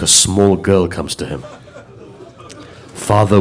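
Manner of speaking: low, hushed grieving telling of the martyrdom; hushed mournful recitation. Acted. Preacher to mourners at majlis, hushed grieving lament.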